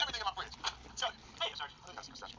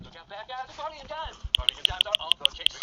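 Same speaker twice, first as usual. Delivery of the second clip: hammed-up cop; 9 semitones higher, 13 dB louder, slower than usual. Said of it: "I'll play Detective Mark Barley".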